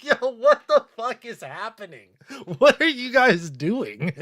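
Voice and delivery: can't hold it together while asking; real laughing fit, words breaking up; laughing in disbelief. Real, not acted. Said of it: "cry laughing".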